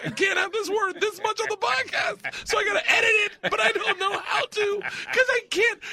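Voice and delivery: loud, very high strained squeal; laughing despair. Retold or imitated. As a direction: wail helplessly while cracking up. Retells editing despair, cracking up.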